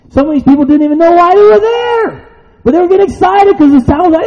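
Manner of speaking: very high, excited shout from the pulpit; shouted preaching. Real, not acted. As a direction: shout the uproar out to the congregation. Preaches at full force.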